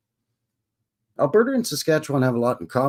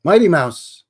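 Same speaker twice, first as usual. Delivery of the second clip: calling out; 5 semitones higher, 8 dB louder than usual. Real, not acted. Loud shout-out greeting a viewer.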